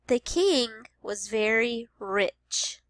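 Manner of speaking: slow, clear The king was very rich; slow teacher read-aloud; slow modeled sentence. Shown as a demonstration. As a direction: read slowly. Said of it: "listening for the ch sound".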